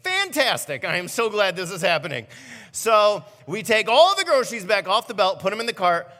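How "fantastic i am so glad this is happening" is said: bitter sarcasm at the cashier; sarcastic. Retold or imitated. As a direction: say it as sarcastic fake cheer. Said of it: "And I was like".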